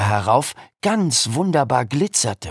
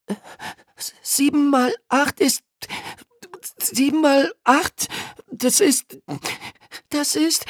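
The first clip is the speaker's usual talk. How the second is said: hesitant, halting stammer. Acted. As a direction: stumble over the sum.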